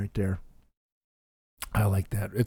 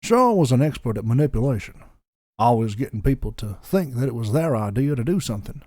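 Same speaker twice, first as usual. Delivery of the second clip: put-on Southern storyteller with a lilting Georgian accent. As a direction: narrate a backstory in a lazy Georgian accent. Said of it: "I'll get to Georgian".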